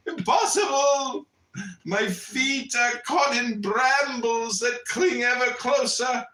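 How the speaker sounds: slow, high, loud terror; panicked shout. Acted. Trapped sleeper to his friend, panicked shout. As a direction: scream he is trapped. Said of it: "said the sleeper".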